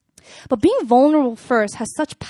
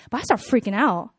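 ordinary talk, nothing marked

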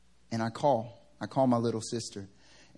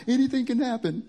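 high, louder insistent mom; 12 semitones higher, 5 dB louder than usual. Retold or imitated. Son imitating mom insisting to him in a high worried voice.

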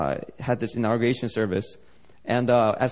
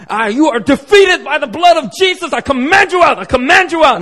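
much higher and louder, commanding; Pentecostal deliverance shout; 14 semitones higher, 11 dB louder than usual. Retold or imitated. Pastor on the video casting out a demon, shouting commands. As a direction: rebuke the demon at full force like an old-school Pentecostal. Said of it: "going old school Pentecostal style".

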